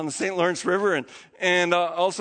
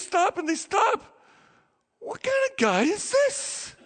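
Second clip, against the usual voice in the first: high, amazed, half laughing; stunned disciples whispering in wonder, pitched up; awed disbelief; 12 semitones higher than usual. Retold or imitated. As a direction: marvel at what you just saw. Disciples awed after calm.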